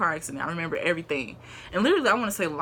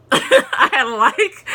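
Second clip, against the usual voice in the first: high laughing voice through the words; 8 semitones higher, 11 dB louder, slower than usual. Real, not acted. Laughing mid-story.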